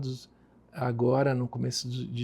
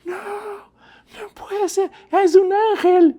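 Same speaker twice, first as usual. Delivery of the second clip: retold shriek, much higher and louder, slowed; hysterical scream; 7 dB louder, slower than usual. Nun seeing her brother's photo, shrieking hysterically. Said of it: "ela começou a gritar".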